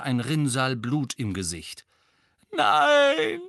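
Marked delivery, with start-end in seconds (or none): 2.5-3.4 high, loud anguished moan. A narrator's reading. Mrs Weasley moaning at her husband's corpse. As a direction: moan the no in despair. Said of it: "stöhnte Misses Weasley".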